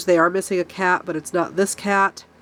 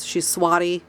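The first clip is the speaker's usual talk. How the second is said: normal talking voice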